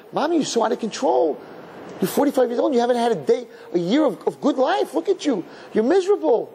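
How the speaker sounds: very high, pleading sympathy; high-pitched acted dismay; exclaimed pity. Child silently pitying out-of-control mother.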